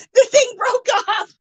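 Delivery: high squeal through laughter; real laughing. Real, not acted. Streamer telling viewers the prop broke, laughing. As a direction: squeal it out while cracking up.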